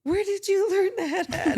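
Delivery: high, loud laughing question; voicing her daughter's teasing through laughter. Grandmother voicing daughter, laughing at her mother's choice words.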